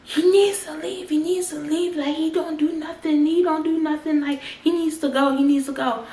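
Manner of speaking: mock the boyfriend's high-pitched whiny yelling; shrieking boyfriend. Boyfriend telling the mother her son must go, high mocking voice.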